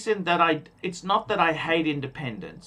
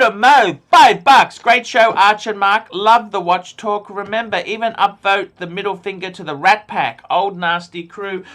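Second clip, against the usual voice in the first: loud, punchy announcer read; superchat shout-out; 3 semitones higher, 10 dB louder than usual. Acted. Host reading donations to the live chat, loud announcer read. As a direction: call out each donor like a host. Reads out paid chat messages.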